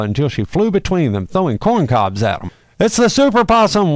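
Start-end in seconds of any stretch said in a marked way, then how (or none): 2.8-3.9 high, loud cry of alarm; panicked yell. Acted. Coyote spotting the flying possum, high alarmed yell. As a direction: shout the warning to the pack. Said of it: "one of the coyotes yelled".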